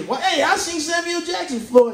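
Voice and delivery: high and eager; husband gushing to his wife, high; excited husband. Acted. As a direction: blurt it out to the wife.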